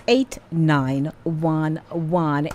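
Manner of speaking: eight nine one one read slowly, digit by digit; slow phone number. Shown as a demonstration. Radio host slowly repeating the number for callers, digit by digit. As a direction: spell out the last digits slowly for listeners. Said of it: "Our telephone number is".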